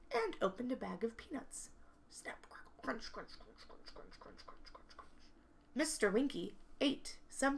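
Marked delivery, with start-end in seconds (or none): 2.1-5.1 soft unvoiced crunch effect; whispered crunching. Imitates eating peanuts.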